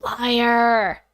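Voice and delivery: ordinary delivery, nothing marked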